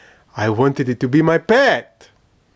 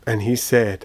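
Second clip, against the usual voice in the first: ordinary delivery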